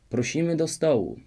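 calling out. A narrator's reading. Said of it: "wołał król".

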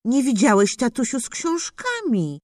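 plain reading voice

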